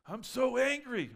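high, slow, loud mocking wail of anger; mock angry whine. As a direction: ham up the outrage. Mocking an enraged person.

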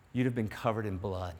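ordinary talk, nothing marked

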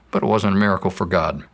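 usual speaking voice

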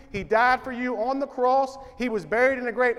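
ordinary delivery

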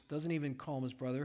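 ordinary talk, nothing marked

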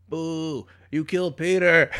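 high, loud boo and accusation; hammed-up angry fan shout; acted heckler. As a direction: heckle the stage, outraged in play. Acted heckler resumes booing.

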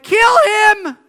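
high furious shout of a mob; crowd yelling. Retold or imitated. Preacher voicing the crowd in Acts, furious shout. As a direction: bellow it like a lynch mob. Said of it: "and they're like".

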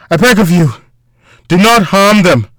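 loud, high, desperate begging; pained plea. A narrator's reading. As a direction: call out, begging for their lives. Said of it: "called out in a pained tone".

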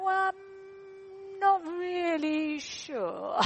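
lightly voiced lost child, slow and unsure; unsure little boy. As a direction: drag it out like a puzzled boy. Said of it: "And he's going".

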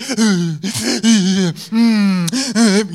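hammed-up hiccupping sob breaths; loud mock shuddering gasps; imitated post-tantrum gasping. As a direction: mimic a toddler who can't catch breath after crying. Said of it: "she can't stop breathing".

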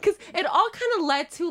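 giggling through the words; laughing. Real, not acted. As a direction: laugh while you say it. Laughing at own story.

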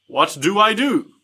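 lightly mimicked odd, flat-footed line reading; offbeat delivery. Mocking Bill's offbeat delivery of the line, mildly imitated. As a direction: parody a strangely delivered line. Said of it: "really strange the way he delivers these lines".

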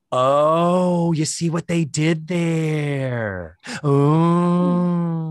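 loud, slow drawn-out mocking "oh"; milk the groan at the cheesy pun; sarcastic groan. Real, not acted.

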